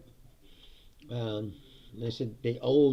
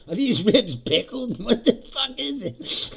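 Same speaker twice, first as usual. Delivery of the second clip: slower, half-laughing disbelief; slow laughing disgust; 11 semitones higher, 6 dB louder, slower than usual. Retold or imitated. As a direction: drag it out while laughing at the ribs. Disgusted at the vinegar ribs.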